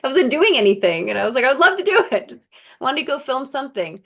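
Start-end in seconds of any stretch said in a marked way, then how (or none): 1.4-2.3 high, loud enthusiastic yes; eager yes. Retold or imitated. Accepting the director's film role, high and eager. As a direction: jump at the offer.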